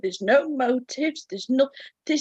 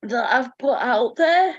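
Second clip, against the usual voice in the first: crying. Real, not acted. Telling the group they answered everything, crying. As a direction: sob it out. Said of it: "I'm sat here in bits".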